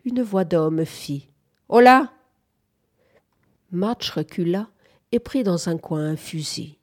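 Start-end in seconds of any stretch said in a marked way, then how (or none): 1.7-2.1 loud, high hail; a raised hello at the door; calling out. A narrator's reading. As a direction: call out sharply to whoever is inside. Man calls out at the door.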